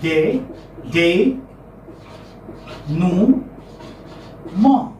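"de, nu, ma" in separate, spaced syllables; slow transcription demo, "de... nu... ma" with long gaps. Shown as a demonstration. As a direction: dictate "de", "nu", "ma" one sound at a time. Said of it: "How do we transcribe it?".

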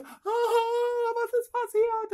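very high, loud panicked cry; mother running to her crying child, panicked and very high. Retold or imitated. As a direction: shriek it in sudden panic. Rushes to crying daughter.

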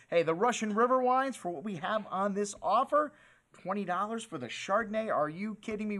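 usual speaking voice

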